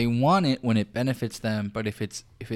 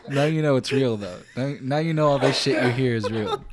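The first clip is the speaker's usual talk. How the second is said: laughing; 2 semitones higher, 3 dB louder than usual. Real, not acted. Laughing after water spill.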